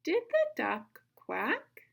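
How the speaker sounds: high, slow "Did the duck", then lifted "quack"; phrase "Did the duck / quack?" as a loud, clear question; phrasing demo. Shown as a demonstration. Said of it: "we're going to work on our phrasing".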